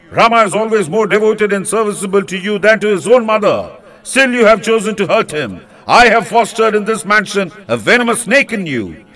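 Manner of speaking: wrathful censure. Acted. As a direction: rage at her, then beg at her feet. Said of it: "he broke out into wrathful censure".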